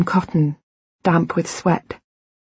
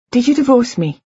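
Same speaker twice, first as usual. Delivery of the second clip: higher-pitched, insistent question; pressing him, voice raised in pitch; urgent demand; 7 semitones higher, 3 dB louder than usual. A narrator's reading. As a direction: demand an answer, pitch jumping up. Question repeated, now demanding answer.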